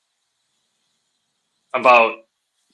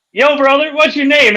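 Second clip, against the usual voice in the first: high-pitched hail to a stranger; calling out a challenge; 11 semitones higher than usual. Retold or imitated. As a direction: accost him in a comic high voice. Skit character accosting the man.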